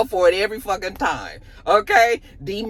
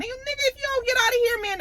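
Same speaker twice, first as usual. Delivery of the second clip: mock threat; 3 dB quieter, faster than usual.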